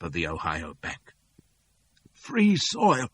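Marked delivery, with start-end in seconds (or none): 2.1-3.1 sobbing. A narrator's reading. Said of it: "sobs Cassie".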